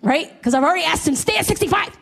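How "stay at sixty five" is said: high-pitched exasperated demand; hammed-up irritation, pitch jumping up; mock angry. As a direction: act out a wife losing patience. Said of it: "now I'm getting angry".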